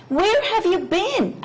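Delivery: high, different voice asking where he was; a curious hive bee; other bees asking. A narrator's reading. Hive bees ask the returning bee, high and curious. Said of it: "asked the other bees".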